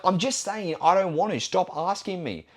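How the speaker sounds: high, insistent retold protest; exasperated refusal. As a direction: act out losing patience with a mate. Retelling his refusal to pushy mate.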